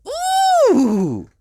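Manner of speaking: very high, loud ooh; amazed ooh. A narrator's reading. George seeing the cave paintings, very high and amazed. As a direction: coo in wonder. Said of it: "cried George".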